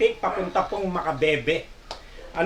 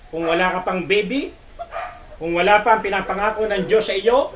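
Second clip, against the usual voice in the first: usual speaking voice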